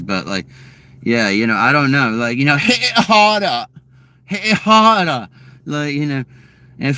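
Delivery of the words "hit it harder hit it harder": mock British moaning demand, pitched up; mock British sexy talk. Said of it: "sexy time noises".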